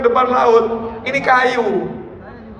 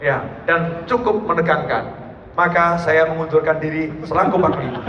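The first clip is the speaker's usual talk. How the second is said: mock resigning commander; 4 semitones lower, 4 dB quieter than usual. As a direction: hand in your resignation with fake gravity. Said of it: "mungkin kalau kita". (pura-pura panglima mundur dengan nada rendah dan lirih)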